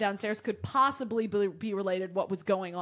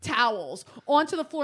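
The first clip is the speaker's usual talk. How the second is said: usual speaking voice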